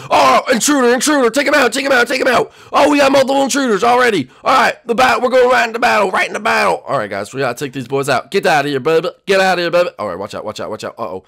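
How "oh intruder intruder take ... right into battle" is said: loud, high-pitched urgent shouting; battle alarm. Acted. Acted alarm at intruders in game.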